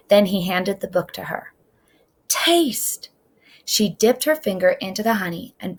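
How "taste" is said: whisper. A narrator's reading. Grandpa offers honey to girl.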